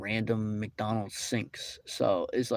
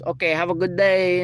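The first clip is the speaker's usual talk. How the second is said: higher, bright fake-polite farewell; mock cheery worker; 7 semitones higher, 4 dB louder than usual. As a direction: play the worker's sugary brush-off. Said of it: "and then she goes".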